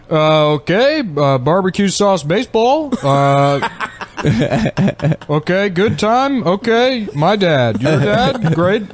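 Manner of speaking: high mock Obama gibberish, slow in places; Obama impression by non-English speaker. Imitating the Chinese Obama impersonator to friends, high mock Obama gibberish. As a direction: ham up a fake Obama speaking nonsense English. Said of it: "he does an Obama impression".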